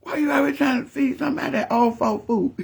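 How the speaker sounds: high, laughing through the words; barely holding in a laugh; quoting grandma, cracking up; laughing while quoting. Retold or imitated. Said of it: "Then she said".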